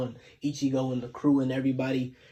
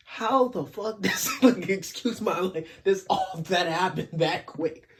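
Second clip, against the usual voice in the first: higher, drawn-out disbelief with a laugh; incredulous; 5 semitones higher than usual. Real, not acted. Recapper stunned at how quickly so many died, incredulous. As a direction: laugh in disbelief, stretching the words out. Reacting to how fast it happened.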